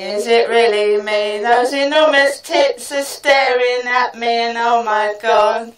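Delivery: usual speaking voice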